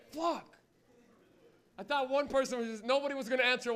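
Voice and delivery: usual speaking voice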